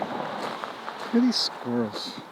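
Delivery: slow unvoiced whisper. Real, not acted. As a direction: hush it so the squirrels stay. Pointing out nearby squirrels.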